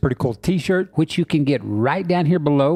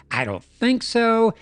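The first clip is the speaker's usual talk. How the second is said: higher, slow, mock-tough refusal; a hammed-up heroic standoff line; defiant Bigfoot; 8 semitones higher, slower than usual. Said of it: "Bigfoot's like".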